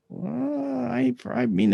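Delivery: high, drawn-out hesitant doctor; hedging. Retold or imitated. Doctor answering whether it's normal, hedging.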